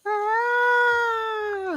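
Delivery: high-pitched, drawn-out mock scream; long high "ah" without extra volume; Kamala scream. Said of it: "you hear Kamala scream".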